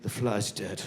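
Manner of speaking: low, soft mock remorse. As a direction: mourn the fly in a hushed voice. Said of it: "You're going".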